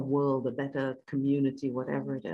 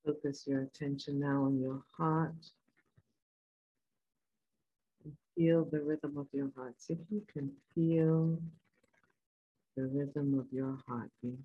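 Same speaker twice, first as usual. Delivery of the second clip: guided meditation; 3 dB quieter than usual. Acted. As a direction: calmly guide them inward.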